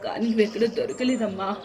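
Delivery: ordinary talk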